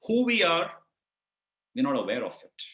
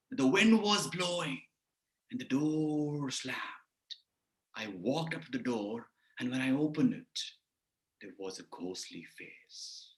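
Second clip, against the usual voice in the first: dramatic storytelling; 4 semitones lower, 6 dB quieter than usual. Shown as a demonstration. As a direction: draw out the suspense, ever softer and lower. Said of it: "If I say like this".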